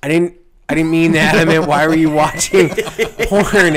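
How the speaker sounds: loud, high, incredulous scolding; imitated exasperated yelling; exasperated doctor. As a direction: act out a doctor at his wits' end. Acts out exasperated doctor on phone.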